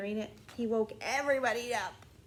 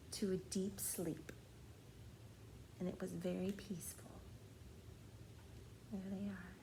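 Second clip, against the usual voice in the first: hushed storytelling; 11 dB quieter than usual. A narrator's reading. Bedtime story, hushed calm moment.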